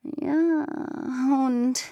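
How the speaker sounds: slow, drawn-out greedy cat; sly kitten. Acted. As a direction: ask slyly and slowly. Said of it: "fragte ganz leise".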